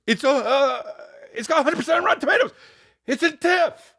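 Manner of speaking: very high, strained squeal; worked-up squeaky outburst; high-pitched outburst. Acted. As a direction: squeeze the words out high and fast. Wound-up high outburst about film.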